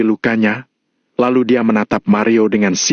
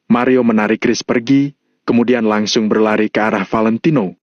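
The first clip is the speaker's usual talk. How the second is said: ordinary delivery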